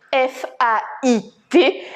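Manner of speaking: F A I T spelled slowly, letter by letter. Shown as a demonstration. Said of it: "avec le mot fait".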